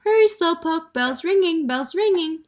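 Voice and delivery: high, loud yelling parrot. A narrator's reading. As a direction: shriek after her. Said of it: "Penelope yelled after her".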